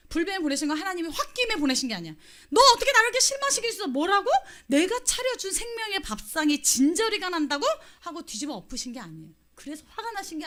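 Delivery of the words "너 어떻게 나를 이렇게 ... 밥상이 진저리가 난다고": high, loud, fast furious rebuke; God imagined snapping at the grumblers, loud and fast; mock angry outburst. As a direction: blow up like a hurt, furious parent.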